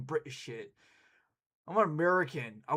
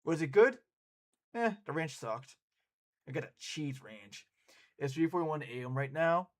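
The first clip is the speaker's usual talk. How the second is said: ordinary delivery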